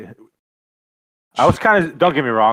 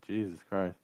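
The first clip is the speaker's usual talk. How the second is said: muttered aside; 14 dB quieter than usual. Retold or imitated. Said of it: "I was like".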